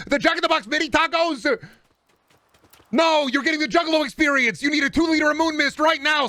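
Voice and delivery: high-pitched, hyped-up comic tirade; ranting. Acted. Streamer prescribes the Juggalo experience, frantic rant. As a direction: rant at full pitch like urgent orders.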